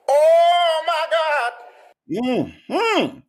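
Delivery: very high, delighted squeal; excited squeal. Real, not acted. To chat about the cake, squealing high with delight. Thrilled at the Socket Timmy cake.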